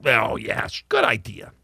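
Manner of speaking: whispered mock approval; sarcastic whisper. Real, not acted.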